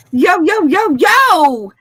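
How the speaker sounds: hammed-up attempt at sounding street.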